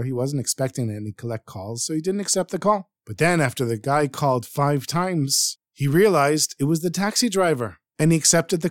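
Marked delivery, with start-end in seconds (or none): none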